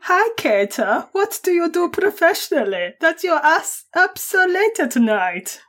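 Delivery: put-on German accent. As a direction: read the chat line in a hammed-up German accent. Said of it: "I might start off with an accent".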